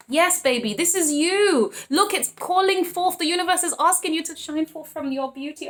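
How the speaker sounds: real delighted hype; exultant. Real, not acted. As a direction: cheer them on with stretched, high-pitched emphasis. Exulting over the judgment card.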